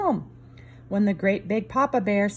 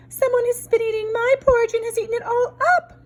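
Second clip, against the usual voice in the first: put-on high, tiny baby bear voice; baby bear piping high and small; wee small voice; 4 dB louder than usual. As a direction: squeak it like a little cub. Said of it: "in his wee small voice".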